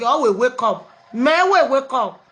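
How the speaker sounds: usual speaking voice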